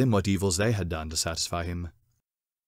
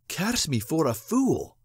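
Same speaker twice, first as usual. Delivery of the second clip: self-cursing cry; 6 semitones higher, slower than usual. Acted. Hero curses himself in story.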